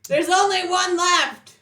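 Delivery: loud, high shout across the room; yelled call. Acted. Fighter calling Zed back, loud yell. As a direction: holler it so they come back. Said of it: "I yell out".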